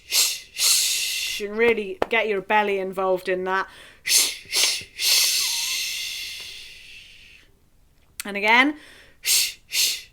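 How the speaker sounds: hard pushed sh from the belly; sh breaths. Shown as a demonstration. Teacher leading belly breathing with sh sounds. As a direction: push each sh out from the belly. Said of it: "we're just gonna do a sh".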